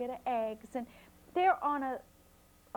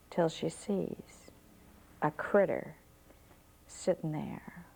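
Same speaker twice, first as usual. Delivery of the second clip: suspense whisper; 5 semitones lower than usual. A narrator's reading. Suspense as fox appears.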